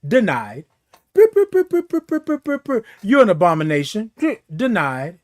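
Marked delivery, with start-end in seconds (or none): 0.1-0.6 system denial. Pretend. Machine refusing the code. 1.1-2.8 high chirpy beeps; quick high beeping; keypad beeps. Retold or imitated. Imitating keypad tones. 4.5-5.2 system denial. Pretend. Machine refusing the code.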